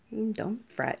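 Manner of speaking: ordinary delivery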